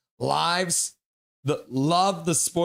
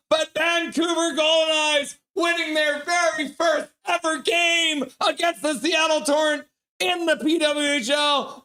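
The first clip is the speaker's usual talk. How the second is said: much higher, shouted goal call; 12 semitones higher, 3 dB louder than usual. Real, not acted. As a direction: erupt like the arena just exploded. Calling overtime winning goal.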